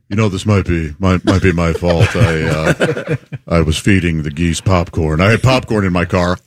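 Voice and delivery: low-pitched, hesitant imitation of a culprit; put-on low voice owning up reluctantly; sheepish confession. Host imitates coworker admitting he fed geese, sheepish.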